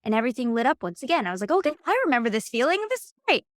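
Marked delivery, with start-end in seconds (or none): 1.5-3.4 bright and quick, reliving the thrill; rising with delight at the memory; excited recall. Retold or imitated. To herself, remembering her dream, excited.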